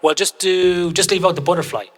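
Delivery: mock coach call. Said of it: "the coaches go".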